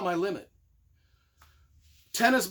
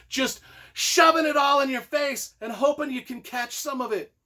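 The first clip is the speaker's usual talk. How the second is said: higher, louder burst of enthusiasm; excited shout; 7 semitones higher, 4 dB louder than usual. Real, not acted. Reviewer raving to his viewers, near shout. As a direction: let the excitement spill over.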